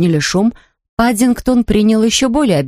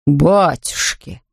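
slow, lower startled exclamation; gasping Mr Brown; 4 semitones lower, much slower than usual. Acted. Father gasps at the golden bear, slow and low. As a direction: gasp it out in shock. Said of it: "ахнул мистер Браун".

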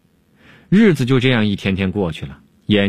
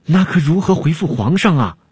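higher, worried and at a loss; flustered panic; 8 semitones higher than usual. A narrator's reading. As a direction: stammer into an alarmed question. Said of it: "惊慌失措地问". (惊慌失措地问)

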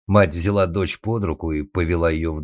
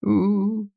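high, drawn-out wail; wailing howl. A narrator's reading. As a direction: howl like a bawling girl. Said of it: "с новой силой заревела Роза".

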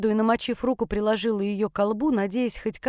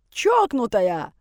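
yelled; 4 dB louder, much slower than usual. A narrator's reading. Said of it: "проорал он мне вслед".

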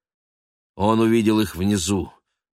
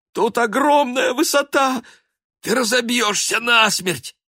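much higher, louder whining plea; whimpering plea; 6 dB louder than usual. A narrator's reading. The dog begging his friend not to jump, whining. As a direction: whine like a frightened dog.

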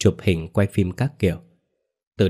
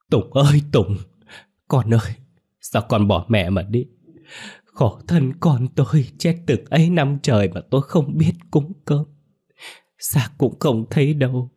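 wailing cry; 5 semitones higher than usual. Acted. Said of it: "mà gào lên".

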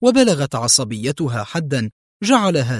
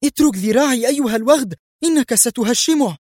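high, quick furious protest; angry shout; 11 semitones higher, 3 dB louder than usual. A narrator's reading. Woman demanding he let go of her arm, angry. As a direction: cry out to be released, pitch raised sharply. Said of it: "صاحت به مارتينا في غضب".

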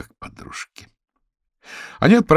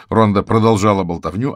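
plain reading voice

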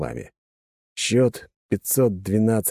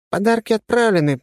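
ordinary delivery, nothing marked

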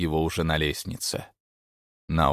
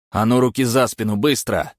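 higher, louder order; barked command; 5 semitones higher, 6 dB louder than usual. A narrator's reading. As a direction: bark hands behind your back. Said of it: "скомандовал он".

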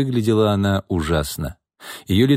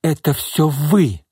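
slow, higher-pitched accusation; 7 semitones higher, slower than usual. A narrator's reading. Secretary blames her boss in public, accusing. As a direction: draw it out to lay blame.

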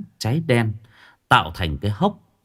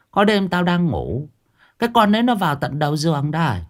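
put-on grumbling old woman, often pitched up; feisty old woman; 5 semitones higher than usual. Old woman insisting to Uncle Hà she saw it, indignant.

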